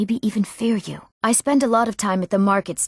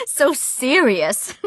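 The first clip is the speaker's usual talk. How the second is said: slow, higher, laugh-edged tease; teasing amusement; 4 semitones higher, much slower than usual. Acted. Teasing someone as too serious, amused. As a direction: draw it out between laughs.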